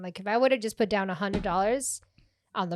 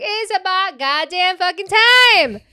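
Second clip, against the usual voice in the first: mock outrage; 10 semitones higher, 15 dB louder than usual. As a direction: bellow it like a diva offended. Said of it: "I was like".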